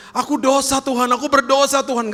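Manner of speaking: high-pitched, pleading objection; hammed-up reluctant believer, voice pushed high; protesting sinner. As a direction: protest your unworthiness to God. Acted sinner objecting to God's invitation.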